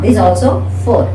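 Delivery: ordinary talk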